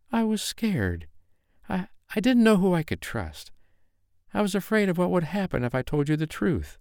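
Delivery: high, tearful confession; a scared girl begging to be believed; frightened young woman pleading. Acted. Mariah confesses her fear to angry KC, high and pleading.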